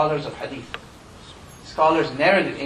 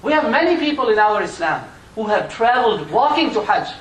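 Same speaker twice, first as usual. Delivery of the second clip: preaching at full force; 7 semitones higher, 6 dB louder than usual. Acted. Preacher telling the crowd of his long walk, impassioned. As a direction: drive it home to the congregation.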